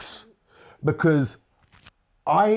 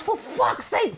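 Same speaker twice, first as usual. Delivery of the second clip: much higher, furious exclamation; angry curse; slower than usual. Retold or imitated. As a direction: swear in outrage. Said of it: "He goes".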